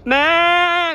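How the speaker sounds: long, loud, high imitated bleat; sheep bleat. Calling to the baby sheep, imitated bleat.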